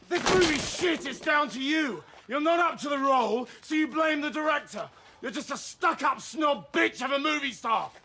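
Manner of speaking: shouted insults in a row; angry accusation. Acted. Man blaming the star for the film, angry. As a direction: lay into her, hammering each jab. Screaming match with the actress.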